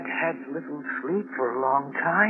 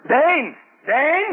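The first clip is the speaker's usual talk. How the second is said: calling out; 9 semitones higher, 4 dB louder than usual. Acted. Calling for his dog in danger.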